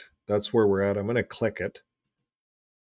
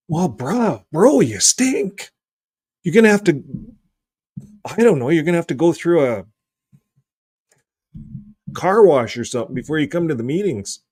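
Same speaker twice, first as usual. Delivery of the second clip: higher, louder mock telling-off; acted reproach; 6 semitones higher, 5 dB louder than usual. Elder telling a brother he stinks, acted reproach. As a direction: put on an exclaiming, scolding elder.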